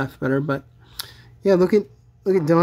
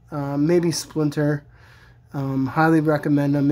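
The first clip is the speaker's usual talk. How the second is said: ordinary talk, nothing marked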